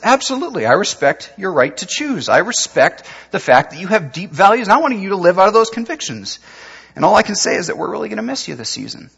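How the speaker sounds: lightly voiced coach. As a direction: sound gracious while letting him go.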